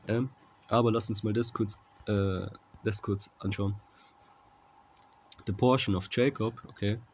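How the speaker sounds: usual speaking voice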